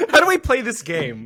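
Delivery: high, fast, giggly question; laughing question. Real, not acted. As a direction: ask it through real laughter. Players joking after cheering initiative.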